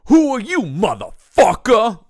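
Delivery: high, loud, slow snarl of a challenge; shouted villain line. Retold or imitated. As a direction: bellow it like a thug.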